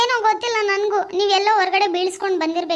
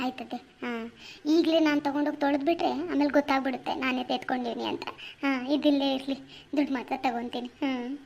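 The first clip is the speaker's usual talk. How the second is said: much softer, sly planning to herself; quiet plotting; 6 semitones lower, 8 dB quieter than usual. Acted. Wife plotting alone about the shirt money, softly sly. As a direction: keep it hushed, she's scheming.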